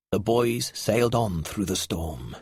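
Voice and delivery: ordinary delivery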